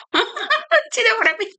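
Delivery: high giggly laughing question. Real, not acted. Vlogger laughing at her own spelling rant, giggly. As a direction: laugh through the words.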